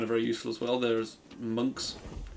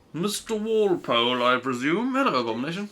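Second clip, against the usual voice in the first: higher, hammed-up English accent with a hint of Welsh; mock-formal English accent, faintly Welsh; put-on English accent; 5 semitones higher than usual. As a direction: greet formally in your best English accent. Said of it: "Put on my best English accent with a still hint of".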